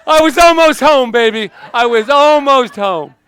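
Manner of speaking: high, loud hammed-up lament; mock wail. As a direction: cry out the loss, overplayed. Mock lament over popped balloon.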